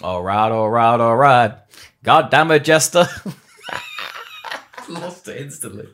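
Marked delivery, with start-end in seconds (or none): 0.0-1.5 slow American drawl, warming into the role; Matthew McConaughey. Acted. Actor getting into the test pilot role, McConaughey drawl. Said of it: "I wanted to get into the role". 2.0-3.1 American test pilot cursing, loud; Matthew McConaughey. Acted. Drunk veteran pilot cursing at his retirement, McConaughey type. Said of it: "I wanted to get into the role".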